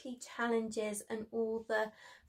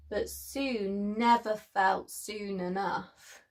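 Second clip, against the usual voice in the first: usual speaking voice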